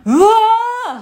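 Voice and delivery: high, loud cry of うわー; a manga-style gasp of shock. Retold or imitated. A woman imitating her parent reacting to her bruise. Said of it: "て言うんですよ".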